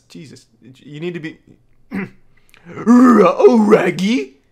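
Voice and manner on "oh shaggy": higher, much louder cartoon dog wail; Scooby-Doo impression. Showing off his spot-on Scooby-Doo, loud dog howl. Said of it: "impression of Scooby-Doo".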